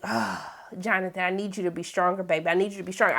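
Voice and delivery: low, soft, coaxing murmur; mock pleading. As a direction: plead gently as if he could hear you. Mock coaxing the man on screen.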